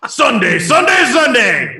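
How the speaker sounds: loud, high event-promo bellow; booming arena-ad shout that overloads the mic; overmodulated announcer shout. Shown as a demonstration. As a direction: belt it out like a hype announcer, stretching the last word. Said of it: "you were overmodulated there".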